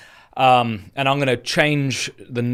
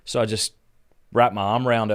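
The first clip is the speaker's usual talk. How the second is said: ordinary talk, nothing marked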